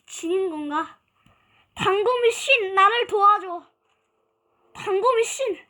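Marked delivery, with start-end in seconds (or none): 1.7-5.6 mock prayer. Pleading to dagger god.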